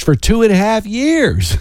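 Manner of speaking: YEARS stretched high, then falling; emphatic exaggeration. Real, not acted. Radio host marvelling at a long ring search, emphatic exaggeration. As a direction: lean hard on "two and a half years".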